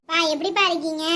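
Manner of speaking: normal talking voice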